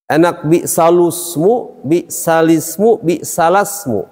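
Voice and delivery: slow "bi salusmu, bi salismu, bi salasmu" comparing the U, I and A vowels; trying vowels. Shown as a demonstration. Teacher tries each vowel for students. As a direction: test each vowel slowly on the tongue. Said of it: "Di lidah enak nggak?".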